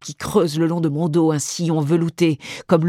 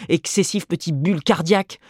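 ordinary delivery

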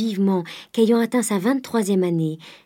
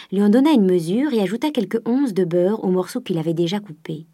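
usual speaking voice

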